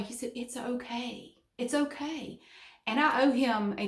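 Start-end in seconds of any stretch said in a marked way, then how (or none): 0.4-2.4 slow, soft, soothing imitation; retold reassurance. Boss comforting her in tears, retold slow reassurance. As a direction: gently lift and stretch it, as if comforting.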